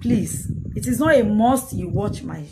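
ordinary talk, nothing marked

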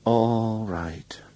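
weary, breathy giving in; wife giving up the argument, tired whisper. A narrator's reading. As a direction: sigh it out, worn out. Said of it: "she said in a tired voice".

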